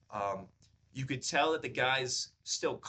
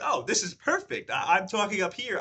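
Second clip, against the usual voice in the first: excited; 8 semitones higher, 7 dB louder than usual. Retold or imitated. Retold excited reaction.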